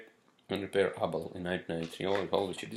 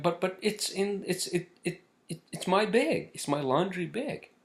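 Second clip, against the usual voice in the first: high, stammering mock protest; confused protest; 9 semitones higher than usual. Customer objecting to laundry lady on phone, stammered confused protest.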